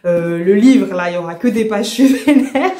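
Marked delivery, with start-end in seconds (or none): 1.9-2.7 "Je suis vénère" through a laugh, a bit higher and louder. Real, not acted. Vlogger laughing at her own anger, laughing. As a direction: laugh out the complaint. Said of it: "je suis vénère pendant toute ma lecture".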